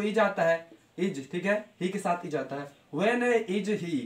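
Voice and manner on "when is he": slow dictation. Shown as a demonstration. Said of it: "teacher dictating".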